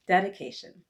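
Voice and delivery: plain reading voice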